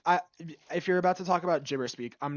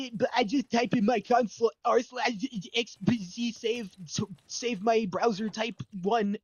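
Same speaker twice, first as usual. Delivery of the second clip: high whiny nerd imitation; 7 semitones higher, 4 dB louder than usual. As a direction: squeak it out like a know-it-all nerd. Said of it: "you have your fucking".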